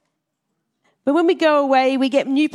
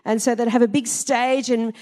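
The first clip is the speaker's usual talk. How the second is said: ordinary talk, nothing marked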